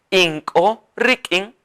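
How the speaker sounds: Inko rikin drawn out; slow dictation. Shown as a demonstration. Teacher models the phrase for the class. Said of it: "Todos juntos".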